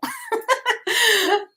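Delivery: high laugh after an impression; amused laughter at one's own imitation. Real, not acted. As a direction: burst into high-pitched giggles.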